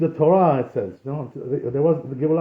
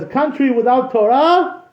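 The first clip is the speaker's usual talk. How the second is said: higher, louder and slow, a solemn lament; raised, grieving pronouncement; the old rabbi's grave warning; 8 semitones higher, 6 dB louder, slower than usual. Retold or imitated. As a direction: intone it like a weeping old sage. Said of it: "So he told him, he says".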